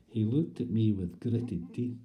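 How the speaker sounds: ordinary talk, nothing marked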